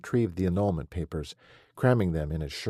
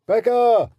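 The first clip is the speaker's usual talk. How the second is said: very loud, much higher holler; 11 dB louder than usual. A narrator's reading. Husband hollering for his wife through the house, full yell. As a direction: bellow her name so the neighbors could hear. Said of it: "he hollered loud enough".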